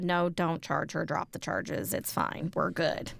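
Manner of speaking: low, soft quoted reassurance; understated, hushed brush-off; imitated husband's dismissal. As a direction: play the husband quietly waving it off. Voicing husband waving off the police.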